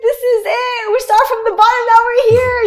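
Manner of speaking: high, loud squealing cheer; over-the-top celebratory shriek; excited friends cheering. Retold or imitated. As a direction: cheer her on like thrilled girlfriends. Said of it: "And they're like".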